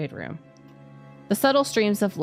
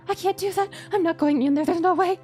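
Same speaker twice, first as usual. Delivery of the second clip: high frightened protest; terrified refusal, voice up high; scared horror game streamer; 12 semitones higher than usual. Acted. Refuses to enter torture room.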